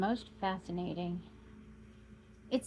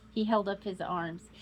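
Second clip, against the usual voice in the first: usual speaking voice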